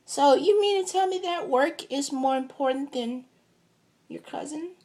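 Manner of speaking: put-on a kid guilt-tripping her cousin; little girl voice. Cousin scolding him for choosing work, high sassy child voice. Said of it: "She said".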